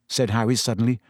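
usual speaking voice

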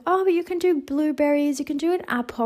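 high, lilting mock-mum voice; mum's voice. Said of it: "my mum was like".

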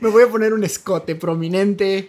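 laughing through the words. Real, not acted. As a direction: giggle while you say it. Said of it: "laughs while speaking".

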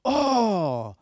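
loud, high delighted oh. Retold or imitated. Host hears guest is born again, bursts out delighted. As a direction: burst out in joy.

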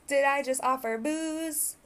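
usual speaking voice

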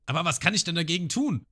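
ratloser Genzo. Retold or imitated. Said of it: "Und Genzo so".

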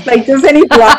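laughing, up high and loud. Real, not acted. Joking about a man who faints at blood, laughing. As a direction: laugh the question out in disbelief.

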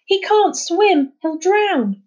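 high, slow, loud fearful cry; frightened cry. Acted. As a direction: gasp it out in fright. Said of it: "Kyla gasped in fright".

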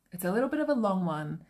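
ordinary talk, nothing marked

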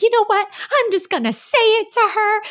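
high-pitched, worked-up declaration; bold resolve. Retold or imitated. Speaker recalls working up nerve to confront her friend, bold resolve. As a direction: blurt it out, pitched right up.

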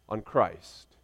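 usual speaking voice

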